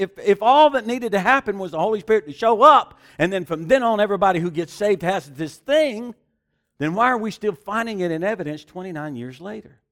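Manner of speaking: loud, high preaching with building fervour; full-force preaching. Acted. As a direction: preach it out to the congregation.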